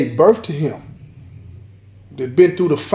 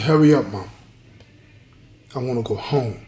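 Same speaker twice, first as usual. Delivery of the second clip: put-on quietly tearful child, voice quivering; a touch of crying, shaky and soft; 4 semitones lower, 3 dB quieter than usual. A narrator's reading. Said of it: "in a shaky, quivering voice, crying".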